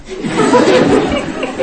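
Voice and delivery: a short high burst of laughter. Real, not acted. Preacher laughing at his own joke, high laugh.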